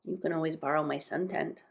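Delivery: soft, subdued offer; quiet offer. A narrator's reading. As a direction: offer it quietly after a pause for thought. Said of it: "he said quietly".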